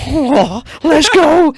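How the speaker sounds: high, loud hype; excited. Retold or imitated. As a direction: cheer it, pumped up. Hyped at backstage prospect.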